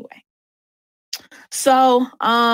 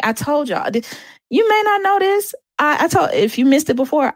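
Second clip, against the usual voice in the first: ordinary talk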